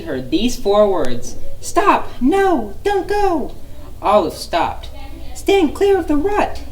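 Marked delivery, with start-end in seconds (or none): 1.6-3.5 urgent high cry. Acted. Squirrel warns Olive urgently. 5.3-6.6 high squeaky squirrel instruction. Acted. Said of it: "instructed a squirrel".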